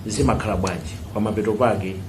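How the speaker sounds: ordinary delivery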